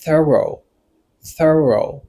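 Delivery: "thorough" in two even, clear syllables, falling; pronunciation demo. Shown as a demonstration. Teacher showing learners the word, "thorough" drawn out clearly. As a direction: model "thorough" carefully on its own. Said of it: "mò sa li pwononse kòm".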